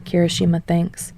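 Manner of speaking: usual speaking voice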